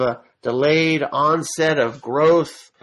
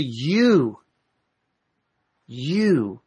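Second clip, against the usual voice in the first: you said slowly and set apart, twice; emphatic you. Shown as a demonstration. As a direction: land on you and let it hang. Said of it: "what's being emphasized is the".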